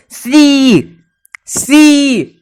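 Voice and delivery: सी said high with a long drawn ee; teacher drawing out सी's long ee. Shown as a demonstration. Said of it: "ये ई जो है थोड़ा लंबा खींच रहा है".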